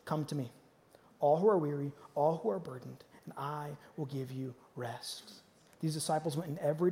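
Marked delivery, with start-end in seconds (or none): 0.0-5.3 soft invitation. Retold or imitated. Pastor quoting Jesus to the congregation, low and soft. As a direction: lower the voice and offer rest. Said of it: "Jesus gives us one step".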